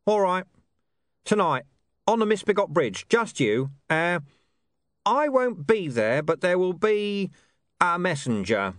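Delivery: cocky street informant; gruff informant. Acted. Deep Bone's voice.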